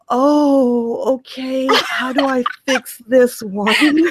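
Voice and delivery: slow, stretched-out mock dismay; awkward dismay. Narrator unsure how to answer a fan's proposal, acted awkward dismay. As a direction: stall awkwardly, drawing the words out.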